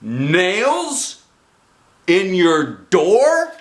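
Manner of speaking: rising into a loud slow yell; outrage building to the end. A narrator's reading. Mother yelling at the nails in the door. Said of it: "she yelled".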